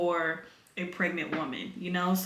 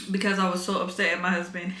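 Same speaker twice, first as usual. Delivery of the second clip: usual speaking voice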